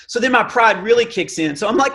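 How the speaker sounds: ordinary talk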